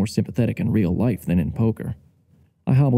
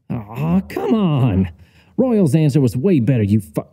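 raised, indignant heckling cut short; exasperated protest; 3 semitones higher, slower than usual. Acted. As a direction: argue with the TV, breaking off mid-curse. Narrator yelling at the TV.

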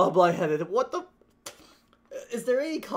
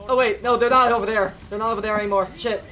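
ordinary talk

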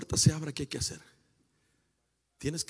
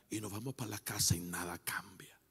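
low, soft, half-whispered remark; hushed aside; 7 semitones lower, 9 dB quieter than usual. Real, not acted. Preacher on religious service changing nothing, hushed and low. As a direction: drop right down, almost voiceless. Deflated aside after loud climax.

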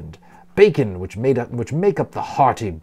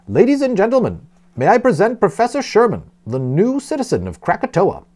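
higher, ringing announcement to the room; loud, clear announcement; 8 semitones higher than usual. A narrator's reading. Host presenting the professor to the breakfast room, loud and clear. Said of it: "announced in a loud, clear voice".

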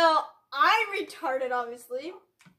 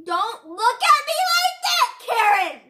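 loud, high, drawn-out scolding; mock angry yell; 7 semitones higher, 11 dB louder, slower than usual. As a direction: yell it slowly in playful outrage. Mock quarrel with friend in game.

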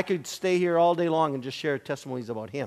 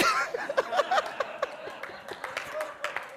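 long high laughing burst; delighted laughter; 6 dB quieter than usual. Real, not acted. Laughter at the healing.

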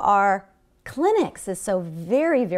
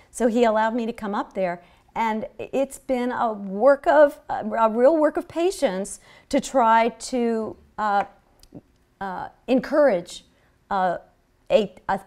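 ordinary talk

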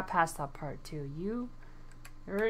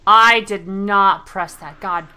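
annoyed; 15 dB louder, slower than usual. Real, not acted. Gamer protests a misclick.

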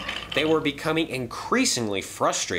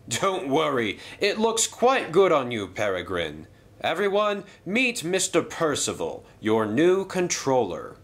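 hearty stately controller, pleased then serious; railway boss voice; 5 semitones higher than usual. Acted. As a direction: address the engines like a proud boss. Hatt introduces Percival.

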